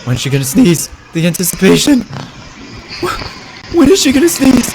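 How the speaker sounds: loud, high, mounting anticipation; impatient suspense. Real, not acted. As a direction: shout it out, unable to wait. Impatient waiting for the sneeze.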